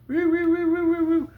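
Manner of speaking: high, loud siren wail; alarm siren imitation. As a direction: mimic a blaring alarm.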